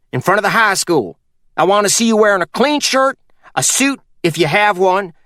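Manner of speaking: put-on a sly old mountain man giving orders; Purvis voiced. Purvis instructs Dewey.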